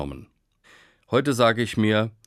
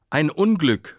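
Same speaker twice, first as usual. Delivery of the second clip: a raised, higher cry of dismay; neighbors calling out; 6 semitones higher, 4 dB louder than usual. A narrator's reading. Neighbors crying out to the farmer over his runaway horse, pitched higher. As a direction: call it out like alarmed neighbors. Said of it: "riefen die Nachbarn des Bauern".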